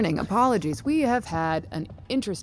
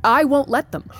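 defiant; 9 semitones higher, 6 dB louder than usual. Acted. Defiant reply in argument.

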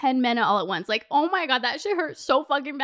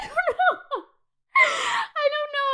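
laughing; 13 semitones higher, slower than usual. Real, not acted. Laughing right after the outburst.

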